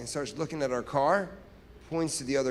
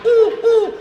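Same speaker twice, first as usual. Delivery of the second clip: high, loud imitated owl hoots; 14 dB louder than usual. Shown as a demonstration. Showing the crowd how an owl sounds, owl hooting. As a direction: hoot like an owl, two loud "oohs". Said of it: "What does an owl sound like?".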